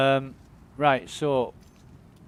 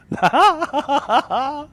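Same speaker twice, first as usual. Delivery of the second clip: high laugh; 13 semitones higher, 9 dB louder than usual. Real, not acted. Laughing at the patch joke.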